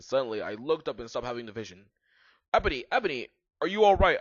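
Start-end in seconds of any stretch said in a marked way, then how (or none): 2.5-4.2 higher, louder, urgent question; worried. A narrator's reading. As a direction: call out with concern. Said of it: "asked Draco in a worried voice".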